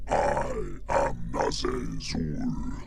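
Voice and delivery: slow, detuned deep monster voice; a slowed, deepened demon line. Shown as a demonstration. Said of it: "I activated it".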